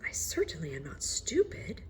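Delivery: soft whisper to himself; whispered thought. A narrator's reading. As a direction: whisper it as a private worry. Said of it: "thought the officer".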